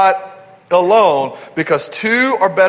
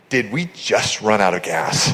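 low, quieter deadpan question; straight-faced; 10 semitones lower, 5 dB quieter than usual. Retold or imitated. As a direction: imitate a wife's deadpan, unamused question. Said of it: "I'm gonna keep a straight face".

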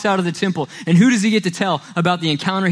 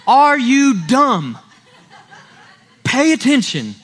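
sarcastic exasperation; 5 semitones higher, slower than usual. Retold or imitated. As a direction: drag it out like talking to someone clueless. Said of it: "And I'm gonna be like".

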